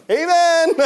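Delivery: very high, loud amen; whooped amen, breaking into laughter; jubilant shout. Retold or imitated. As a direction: holler it out in celebration. Celebrates the answered prayer.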